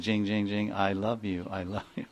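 gentle, dwindling tender chime of love; tender bell voice. Retold or imitated. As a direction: let the bells coo it, tender and fading. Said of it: "they start saying".

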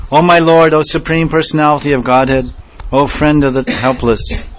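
high, loud imploring cry; fervent plea. Acted. Radharani calling out to her Lord in longing. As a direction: call out to the Lord, pleading. Radharani's prayer read with feeling.

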